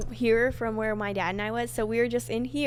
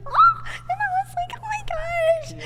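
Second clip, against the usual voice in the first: very high, tearful squeal, re-enacted; mock near-crying high exclamation; overwhelmed gasping; 5 dB louder than usual. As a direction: imitate being overcome, voice shooting right up. Reliving the doors about to open.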